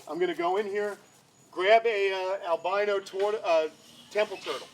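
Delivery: distant voice inside the enclosure; far from mic. Real, not acted. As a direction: call out from across the room. Searching for turtle inside enclosure.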